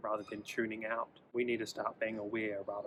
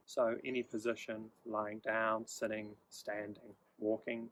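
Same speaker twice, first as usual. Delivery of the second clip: guided meditation. Acted. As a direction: ease the listener into stillness, gently.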